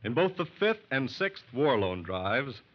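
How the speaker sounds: normal talking voice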